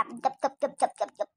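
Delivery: gulping sounds. Acted. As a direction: gulp the water down noisily. Drinking cold water again. (गटगट पानी पीने की आवाज़)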